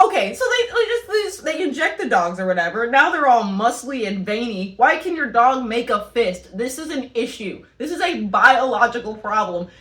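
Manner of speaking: ordinary delivery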